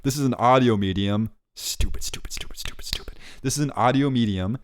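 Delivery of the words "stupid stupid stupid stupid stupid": rapid, voiceless muttering at yourself; whispered self-scolding. Real, not acted. Host kicking himself over a slip, whispered self-scolding.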